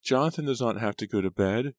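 put-on high little-girl whine; high-pitched, complaining child; whining. A narrator's reading. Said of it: "she whined".

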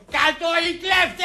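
rowdy slogan cry; drunken shouting. Acted. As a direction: shout it like a tipsy protester. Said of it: "είμαι εντάξει και φωνάζω".

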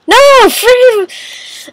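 high, drawn-out cry of no; frustrated outburst. Real, not acted. Player protests a repeated game setback, frustrated.

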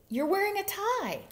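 high, pointed observation; earnest. A narrator's reading. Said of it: "pointed out quite earnestly".

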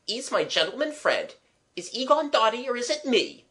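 high-pitched, indignant maidservant; indignant Eliza. Acted. Eliza asking if her gentleman friend has gone dotty, indignant. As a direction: pitch it right up, affronted maid.

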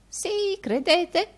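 very high mocking question; old housekeeper. A narrator's reading. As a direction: answer back in a high old woman's voice.

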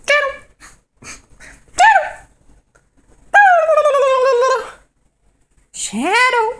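very high sing-song call; calling the dog. Real, not acted. Calling her dog Shadow over, high and loud.

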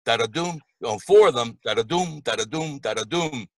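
da-da-doom chanted four times, doom stressed; bouncy galloping verse. Shown as a demonstration. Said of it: "simulates the gallop of a horse".

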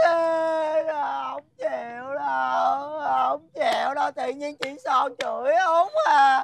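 slow, drawn-out mock bawling; whiny crying, stretching each phrase; childish wailing. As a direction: bawl like a tantruming child. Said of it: "Mày đừng có khóc".

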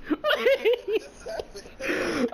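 laughing. Real, not acted. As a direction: try to speak while cracking up. Cracking up at the game.

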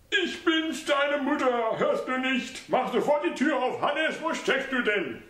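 put-on urgent high voice of the old mother at his door; insistent old mother. A narrator's reading. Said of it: "eindringliche Stimme".

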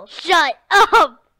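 yelling. Real, not acted. Yelling back at sibling.

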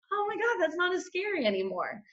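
high, soft, amazed aside; relieved realization. Retold or imitated. Retelling the outpouring of love after posting, softly relieved.

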